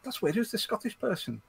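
retold puzzlement, high and soft; puzzled. Listener recalling an unknown Scottish voice, puzzled high aside.

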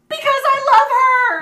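very high, loud shriek. Acted. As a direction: shriek it out desperately. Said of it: "And then Draco shrieked".

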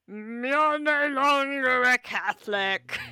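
bull voice. Retold or imitated. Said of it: "the cow would be like".

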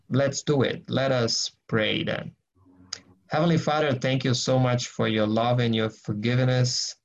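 ordinary talk

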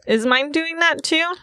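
put-on high, timid little-girl voice; small girl's question. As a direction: ask like a worried small child. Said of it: "she just kinda says".

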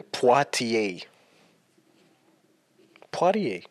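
Poitiers sounded out slowly as "pwah-tee-ay"; spelled-out pronunciation. Shown as a demonstration. Showing a friend how it's written, Poitiers slowly as "pwah-tee-ay". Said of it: "It's written".

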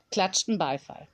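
ordinary delivery

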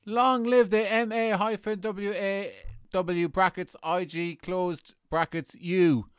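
slow, loud proclamation of letters; slow rallying cry. Acted. Lone member hailing the union's long acronym slowly. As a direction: spell out each letter deliberately.

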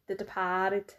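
DEPARTED in a mock Boston accent; Boston-style "Departed", with a drawn-out A; imitated Boston accent. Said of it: "or as you say".

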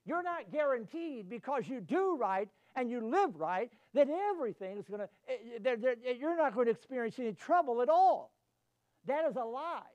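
full-force preaching, loud and rising. Acted. Pastor warning his flock, preaching hard. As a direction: drive it home to the congregation.

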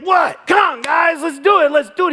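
put-on high, loud kid chatter to teammates; pep up the team like an eager boy; cheering little leaguer. Right fielder rallying his teammates, loud eager kid cheer.

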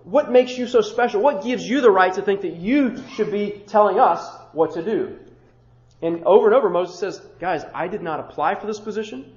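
usual speaking voice

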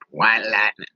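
usual speaking voice

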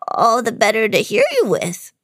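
squeaky put-on granny; high funny voice. Disguised villain answers about his big ears, high funny voice. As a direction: play a villain faking a grandmother's voice. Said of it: "in his high, funny voice".

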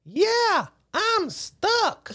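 put-on very high, squeaky child voice; little kid. Trapped kid answers the man outside, high child voice. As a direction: imitate a stuck kid answering in a tiny high voice. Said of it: "And this little kid says".

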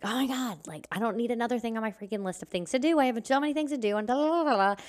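high, fast, frantic overwhelmed rant; defensive rant. Retold or imitated. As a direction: fire it off like you're drowning in to-dos. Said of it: "immediately I got defensive".

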